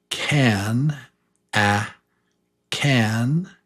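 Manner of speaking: can with a short "a", last one breathed. Shown as a demonstration. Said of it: "So the one on the left".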